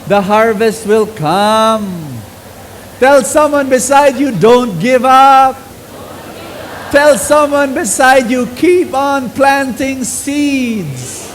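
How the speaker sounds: high, loud, slow rallying preach; preacher urging the congregation not to give up; full-force preaching. Acted. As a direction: rouse the congregation, drawing words out.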